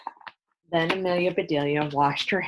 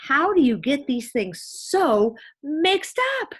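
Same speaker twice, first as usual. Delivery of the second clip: high, loud, slow exasperation; louder, higher, slow exasperated scold; exasperated exclaim; 10 semitones higher, 7 dB louder, slower than usual. A narrator's reading. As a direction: scold in disbelief. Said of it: "exclaimed Miss Rogers".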